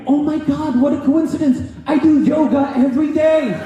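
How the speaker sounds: high, gushing, sing-song wine mom voice; gushing sub. Retold or imitated. Negligent sub gushing over the student's exotic name, high and sing-song. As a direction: coo it like a clueless suburban mom. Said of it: "She goes".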